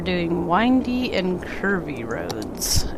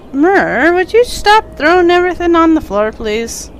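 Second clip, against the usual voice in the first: exasperated scolding; 9 semitones higher, 6 dB louder than usual. Real, not acted. Scolding the cat Merlin.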